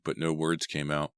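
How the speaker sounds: ordinary delivery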